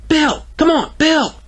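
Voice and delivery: put-on high, pleading child's call; calling after him. Greta pleads with Bill to stop, high calling voice. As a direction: holler high like a kid left behind.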